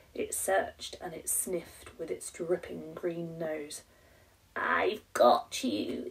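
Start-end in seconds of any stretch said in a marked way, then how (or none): 4.6-6.1 low, slow, whispered gurgle; a menacing creature gurgling; gurgling monster. A narrator's reading. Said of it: "it gurgled".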